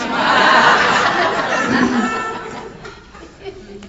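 long high bright laugh; delighted laugh. Real, not acted. Speaker laughing with joy over the sponsee's answered prayer.

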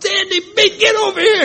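high, loud shouted greeting; hammed-up happy shout from a doorman. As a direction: bellow it across the street with joy. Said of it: "and he yells out".

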